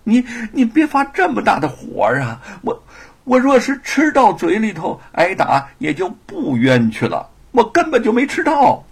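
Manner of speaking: stammering, high and pleading; trembling wry plea. Acted. Thief nervously pleading with the angry scholar, stammering. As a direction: plead nervously with a bitter laugh. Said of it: "汉子战战兢兢地苦笑".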